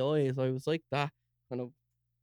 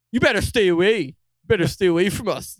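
higher and much louder, a posh voice warning them off; posh mate warning; 5 semitones higher, 8 dB louder than usual. Retold or imitated. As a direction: play the posh friend squaring up. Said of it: "he's real posh".

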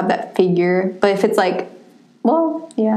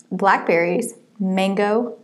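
usual speaking voice

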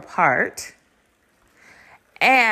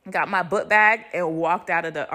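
ordinary talk, nothing marked

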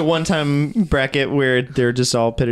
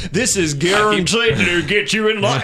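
put-on Southern accent; 5 semitones higher than usual. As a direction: shout it in a country accent. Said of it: "that southern accent".

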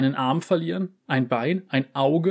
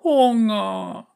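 high, loud cry; hungry cat crying out; 9 semitones higher, 4 dB louder than usual. Acted. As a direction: wail the word out.

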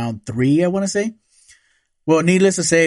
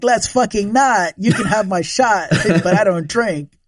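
mock refusal; 6 semitones higher than usual. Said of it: "I was just like".

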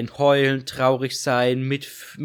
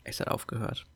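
breathy whisper, no voice at all; 12 dB quieter than usual. Shown as a demonstration. Co-host whispers that the recording stopped. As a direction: whisper it like a secret.

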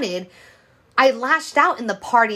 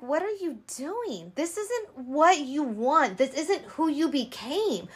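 self-disgust; 4 semitones higher, 7 dB quieter than usual. Retold or imitated. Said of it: "it was more of a, a discuss with myself".

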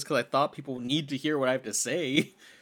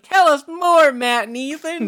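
put-on high, loud, wide-eyed child begging for more; mock eager kid voice; 13 semitones higher, 11 dB louder, slower than usual. Podcast hosts imagine listeners as kids begging, high eager kid voice. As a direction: play a kid at story time calling for more. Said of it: "Just like".

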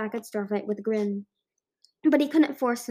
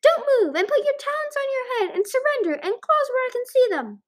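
high-pitched, excited yelling; yelled orders; 14 semitones higher, 6 dB louder than usual. A narrator's reading. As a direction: rattle off orders in a high, raised voice. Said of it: "a voice yelled".

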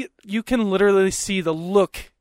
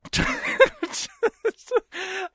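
high laugh; 3 dB quieter than usual. Real, not acted. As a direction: giggle breaking up high. Laughing at his own joke.

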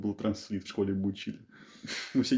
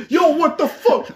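shouted; 8 dB louder, slower than usual. Retold or imitated. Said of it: "Просто".

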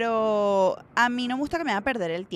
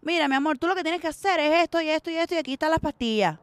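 put-on higher, brisk Cuban-accent doctor; emphatic Cuban accent, pitched up; 5 semitones higher, 4 dB louder than usual. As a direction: imitate a Cuban doctor laying it out. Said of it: "que era un cubano".

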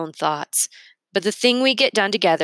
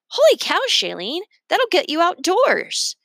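put-on excited kid exclaim; 12 semitones higher, 3 dB louder than usual. A narrator's reading.

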